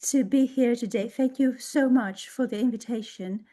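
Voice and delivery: normal talking voice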